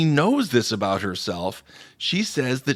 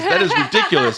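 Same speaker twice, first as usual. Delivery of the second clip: much higher, laughing exclaim; mock outrage; 4 dB louder than usual. Commenter reacting online, high laughing mock outrage. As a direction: squeal it in fake outrage. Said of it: "another person says".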